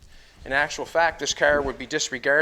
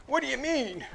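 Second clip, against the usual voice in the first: much higher, puzzled mock voice of the onlooker; confused viewer; 10 semitones higher, 5 dB quieter than usual. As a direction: play the baffled viewer in a high voice. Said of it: "You give me that confused look".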